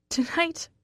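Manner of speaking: strained high croak; cracked, teary voice. Acted. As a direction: croak it through tears. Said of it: "I croak".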